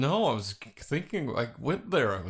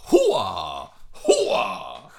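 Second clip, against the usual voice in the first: Al Pacino Hooah shout; loud gung-ho soldier; 8 dB louder than usual. Retold or imitated. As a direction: bark it like a blind colonel. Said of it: "Haven't you seen Scent of a Woman?".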